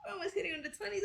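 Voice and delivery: high excited squeal. Real, not acted. Excited about tattoo count.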